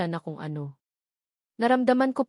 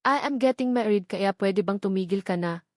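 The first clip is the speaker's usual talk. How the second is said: serious. Acted. Kenzo telling his ex it's over, serious and firm. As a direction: shut her down, no room for argument. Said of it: "Seryosong bigkas ni Kenzo".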